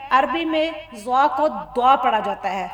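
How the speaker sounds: ordinary talk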